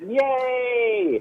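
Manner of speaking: high, drawn-out squeal of joy; excited cheer. Retold or imitated. Comedian re-enacting jumping with a supermodel, excited high cheer. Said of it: "jumped up and down and said".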